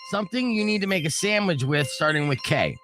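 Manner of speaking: usual speaking voice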